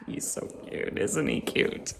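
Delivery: hushed adoring whisper; whispered cooing. Real, not acted. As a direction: coo over the pup under your breath. Cooing over the dog.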